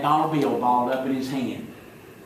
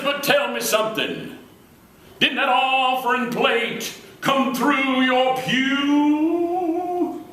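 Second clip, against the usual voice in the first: put-on pitched way up, drawn out slowly like an old preacher; a lilting, stretched-out old reverend voice; high preacher voice; 10 semitones higher, much slower than usual. Comedian imitating the preacher thanking a boy, high and slow. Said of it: "That's the way he talks".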